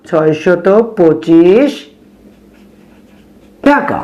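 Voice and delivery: slow answer, last syllables held long; drawn-out words with a long pause; dictating while writing. Real, not acted.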